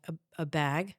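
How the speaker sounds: hesitant, Midwestern vowel on "bag"; Midwestern accent. Retold or imitated. Repeating herself when misunderstood, Midwestern accent. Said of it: "you can hear my accent come out".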